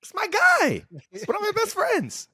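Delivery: very high-pitched, loud squeal of innocence; mock indignant protest. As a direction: protest your friendship in a comic squeak. Protesting being called a bully.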